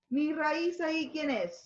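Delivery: normal talking voice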